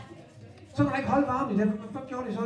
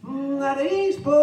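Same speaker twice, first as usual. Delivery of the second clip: high, drawn-out questioning call; 7 semitones higher, slower than usual. A narrator's reading. Peter keeps questioning Jesus from the boat, calling out. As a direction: call it slowly over the waves.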